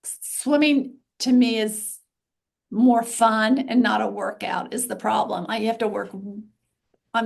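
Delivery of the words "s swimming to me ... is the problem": normal talking voice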